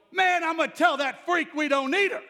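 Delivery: big, high-pitched holler; loud, cocky teenage yell. Retold or imitated. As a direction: shout brashly to a friend in the back room. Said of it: "and so I was hollering".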